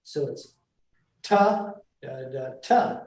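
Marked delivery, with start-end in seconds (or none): 1.2-3.0 TA punched hard at the start, da da lighter; inverted first foot. Shown as a demonstration. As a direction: hit the opening "ta", then let "da, da" drop. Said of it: "is to invert that, that first foot".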